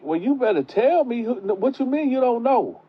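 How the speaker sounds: high, sharp grandma demand; grandma pressing the girl for answers, voice pitched high. Retold or imitated. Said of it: "So she like".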